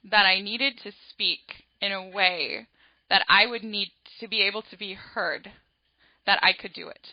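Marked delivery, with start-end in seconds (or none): none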